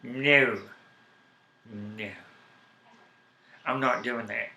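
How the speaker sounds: ordinary delivery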